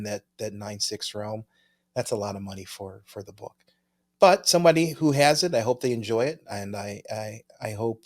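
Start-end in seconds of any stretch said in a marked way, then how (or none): none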